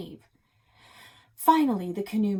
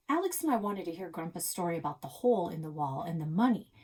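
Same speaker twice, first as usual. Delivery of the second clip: ordinary delivery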